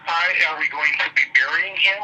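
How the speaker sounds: usual speaking voice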